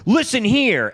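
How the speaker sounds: high, loud mock outburst; stern demand. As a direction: snap it loud as a fed-up interrogator. Kent angrily confronting the kid.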